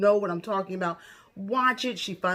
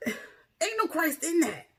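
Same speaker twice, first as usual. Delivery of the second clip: scandalised; 10 semitones higher than usual. Real, not acted. Exasperated commentary after sigh.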